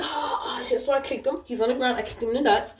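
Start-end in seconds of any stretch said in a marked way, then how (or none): 0.0-0.8 mock pained cry. Man reacting to pepper spray.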